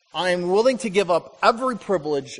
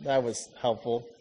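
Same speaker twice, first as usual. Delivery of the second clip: lower, slow, dry mock gratitude; sarcastic thanks; 6 semitones lower, 5 dB quieter, slower than usual. Wife thanking husband for an unwanted chainsaw, sarcastic. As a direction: thank him while clearly hating the gift. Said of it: "You're like".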